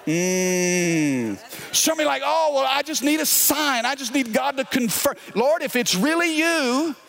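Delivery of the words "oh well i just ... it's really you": higher mock pleading voice; mocking whine. Pastor mocking givers asking for signs, high and whiny. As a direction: imitate a whiny excuse-maker.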